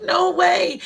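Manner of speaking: a friend's excited exclamation. Retold or imitated. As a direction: blurt it out thrilled. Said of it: "naturally wanna grab their arm and be like".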